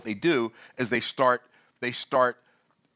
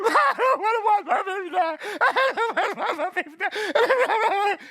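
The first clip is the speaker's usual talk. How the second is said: hammed-up wailing, much higher and slow, laughing through it; mock crying; 3 dB louder, slower than usual. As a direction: overplay a desperate mother crying for her baby. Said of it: "She starts crying".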